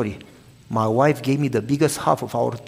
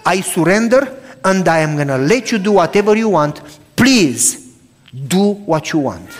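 fervent prayer; 5 dB louder than usual. Acted. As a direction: pray it aloud, giving everything up. Pastor models a surrender prayer.

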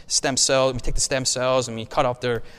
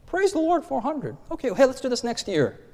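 much higher, bright burst of praise; excited praise; 11 semitones higher than usual. Retold or imitated. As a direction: cheer it like a thrilled organizer. Excited celebration at growing numbers.

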